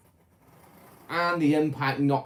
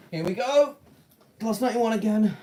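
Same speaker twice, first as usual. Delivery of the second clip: excited; 8 semitones higher, 4 dB louder than usual. Real, not acted. Excited announcing of the train.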